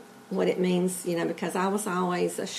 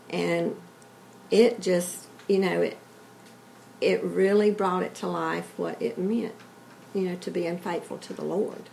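ordinary delivery

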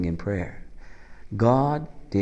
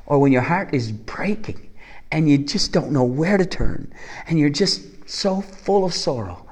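ordinary talk

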